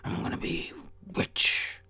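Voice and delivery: whispery, intense superhero voice; Batman. Acted. Psyching himself up in the mirror, superhero whisper-growl. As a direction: push out a breathy vigilante growl. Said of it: "don't wanna sound like Batman".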